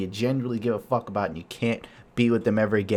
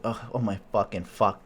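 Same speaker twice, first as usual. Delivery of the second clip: normal talking voice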